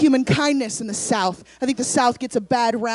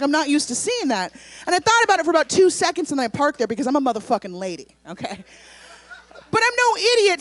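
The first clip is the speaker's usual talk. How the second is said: usual speaking voice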